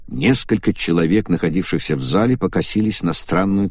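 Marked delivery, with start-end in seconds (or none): none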